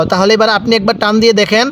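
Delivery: ordinary delivery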